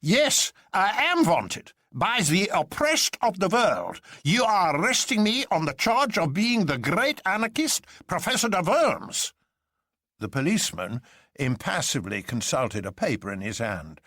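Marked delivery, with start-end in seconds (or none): 0.0-9.3 mock German professor crying out; high German accent. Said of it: "cried in a high German accent".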